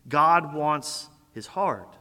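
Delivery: ordinary talk, nothing marked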